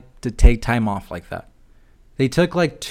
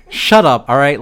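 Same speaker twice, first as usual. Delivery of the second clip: loud snap; 8 dB louder than usual. Real, not acted. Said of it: "snaps back at himself after cheer".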